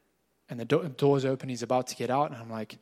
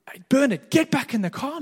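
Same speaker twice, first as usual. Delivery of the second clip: high, louder call across the street; 10 semitones higher, 6 dB louder than usual. Retold or imitated. Pastor calls Bernard back into the car, loud and high. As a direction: call him back before he walks off.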